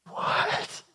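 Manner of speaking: whispered incredulous reaction; stunned whisper. Acted. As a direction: whisper it in utter disbelief. Stunned reaction to fish swallowing.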